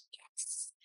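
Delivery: barely audible, voiceless whisper; whispered yes. Acted. Telling herself the pain is awesome, whispered. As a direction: whisper a drawn-in private yes.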